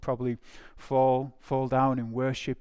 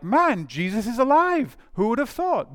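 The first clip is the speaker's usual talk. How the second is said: amazed; 9 semitones higher, 5 dB louder than usual. Acted. Disciples realizing that Jesus is alive, amazed. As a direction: exclaim it high, blown away. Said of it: "these guys are completely amazed".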